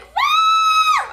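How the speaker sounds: very high, loud celebratory whoop; screamed woo. Retold or imitated. Rider thrilled at surviving a stunt, screamed whoop. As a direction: let out a piercing victory scream. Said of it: "I'm, like, screaming".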